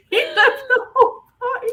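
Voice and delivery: squealed high and loud through laughing; helpless laughter. Real, not acted. Storyteller repeating her awkward punchline, helpless high laughter. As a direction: barely get the punchline out for laughing.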